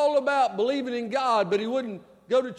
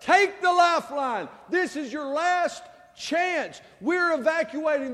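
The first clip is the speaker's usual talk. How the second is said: called out high and loud over the noise; yelled rescue call; 5 semitones higher, 3 dB louder than usual. Retold or imitated. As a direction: shout it down from a hovering helicopter. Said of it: "they yelled out the window".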